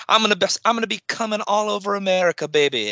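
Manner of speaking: fast, high hammed-up wrestling promo voice; [name]. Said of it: "[name], could you have not worded that".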